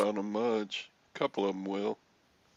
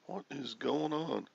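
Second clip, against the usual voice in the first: usual speaking voice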